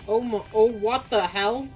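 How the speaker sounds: normal talking voice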